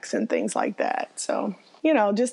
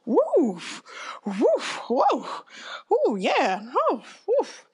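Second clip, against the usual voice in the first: high, gasping woofs at the rum; boss tasting strong cake; 9 semitones higher, slower than usual. Retold or imitated. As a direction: react high to the strong liquor, woof by woof.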